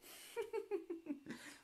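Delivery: high mocking giggle. Ethel sniggering at Mildred's trouble. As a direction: snigger at her rival. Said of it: "Ethel giggled".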